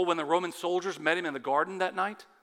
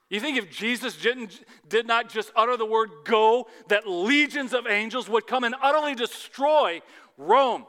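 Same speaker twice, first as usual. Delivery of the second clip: loud and higher, full preaching force; rising pulpit fervor; 6 semitones higher, 9 dB louder than usual. Acted. Preacher challenging the congregation about angels, loud and fervent. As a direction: build the challenge to a peak.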